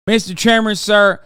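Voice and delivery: grovel like a boot-licking senator; groveling senator parody. Retold or imitated.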